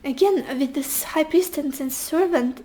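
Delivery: ordinary delivery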